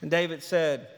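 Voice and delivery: ordinary talk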